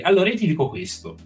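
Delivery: usual speaking voice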